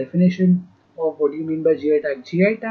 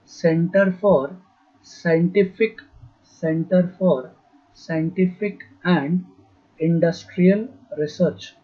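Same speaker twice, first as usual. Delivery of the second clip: slow repeat in separate chunks; slow dictation; slower than usual. Real, not acted. Teacher repeating a name for note-takers, slow dictation. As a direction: dictate slowly so it can be copied. Repeating full form for writing down.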